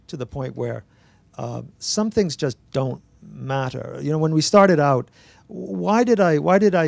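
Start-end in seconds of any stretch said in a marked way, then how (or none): none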